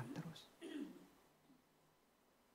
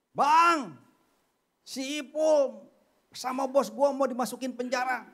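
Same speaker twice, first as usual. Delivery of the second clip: higher, louder, eager friend calling out; excited friend's news; 12 semitones higher, 8 dB louder than usual. Retold or imitated. Friend bursting in to tell the preacher the news, excited. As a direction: blurt the news like a thrilled friend. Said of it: "teman saya bilang".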